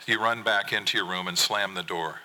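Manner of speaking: ordinary delivery, nothing marked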